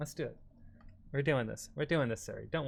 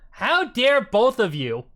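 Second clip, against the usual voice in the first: mock outrage; 6 semitones higher, 8 dB louder, much slower than usual.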